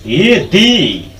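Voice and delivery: ये दी with दी drawn out slowly; long "dee". Shown as a demonstration. Teacher introducing long-vowel THE, दी as slow "deee". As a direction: stretch the ee of दी in ये दी. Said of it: "ददुर्घ है दी".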